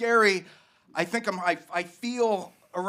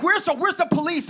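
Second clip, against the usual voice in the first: panicked; 11 semitones higher, faster than usual. Retold or imitated. Frantic call for police.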